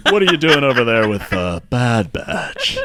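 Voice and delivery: loud, slow cantina guy; Patrick Warburton. Acted. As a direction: drawl it out, big and put-on. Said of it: "some guy who runs a cantina".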